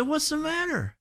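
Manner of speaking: high soft voice asking a coworker what's wrong; concerned question. Retold or imitated. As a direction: ask gently, pitched well up. Asks the head-shaking coworker.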